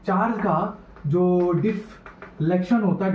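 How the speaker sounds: slow, drawn-out dictation for note-takers; measured teacher dictating at writing speed. Real, not acted. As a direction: spell it out slowly, phrase by phrase. Slowed repeat for students writing.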